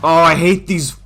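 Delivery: loud, annoyed rant at the corners; frustrated. Real, not acted. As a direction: vent real frustration, slowing at the end. Hates the hairpin corners.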